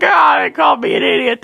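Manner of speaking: high, loud fake toddler wail; mock crying. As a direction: wail in exaggerated baby hurt. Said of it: "I'm crying my ass".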